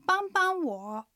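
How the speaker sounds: 帮帮我 said very slowly, each syllable clear, 帮 level then light, 我 dipping; slow Chinese demo. Shown as a demonstration. Teacher modelling 帮帮我 for Japanese listeners, slow and clear. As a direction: spell out 帮帮我 slowly for learners. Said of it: "繰り返して聞いてみましょう".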